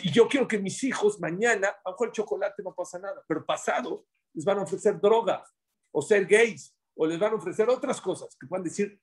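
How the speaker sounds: ordinary talk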